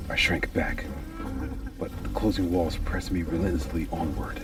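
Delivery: ordinary delivery, nothing marked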